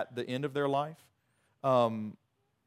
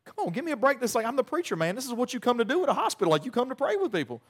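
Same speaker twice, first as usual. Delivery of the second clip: exasperated; 8 semitones higher, faster than usual. Retold or imitated. Preacher's frustration at hospital visit.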